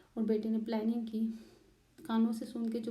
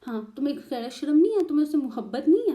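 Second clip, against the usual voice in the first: loud, higher, quick furious yelling; angry shout; 4 semitones higher, 8 dB louder than usual. Retold or imitated. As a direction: yell it at her in outrage. ससुर का गुस्से में डांटना. (ऊँची आवाज़ में तेज़ गुस्से वाली चीख)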